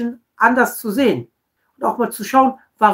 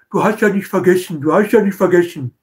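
mock accuser. Mocking accuser's reproach.

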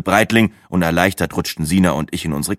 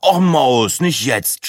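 groaning; 7 semitones higher, 4 dB louder, much slower than usual. A narrator's reading. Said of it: "stöhnte Breitling".

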